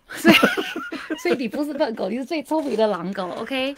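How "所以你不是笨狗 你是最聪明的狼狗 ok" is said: laughing. Acted. Laughing reassurance to co-host.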